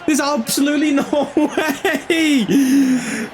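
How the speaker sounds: high, drawn-out wail of disbelief; anguished disbelief. Real, not acted. Gamer who just lost the game, wailing in disbelief.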